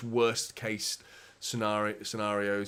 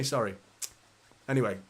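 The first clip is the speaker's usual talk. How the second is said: ordinary talk, nothing marked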